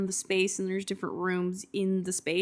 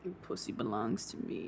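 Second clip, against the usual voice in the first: low growl; 10 semitones lower, 14 dB quieter than usual. Retold or imitated.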